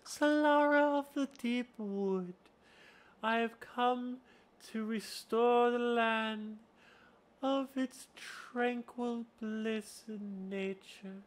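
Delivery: high, slow, airy chant of a woodland being; Solara voice. Shown as a demonstration. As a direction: draw it out high and serene. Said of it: "then you have Solara".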